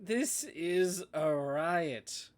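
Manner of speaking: very slow, drawn-out gloating; slow, mocking amusement; mocking relish. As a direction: stretch every word to savour the joke. Joker mocks Pamela in-scene.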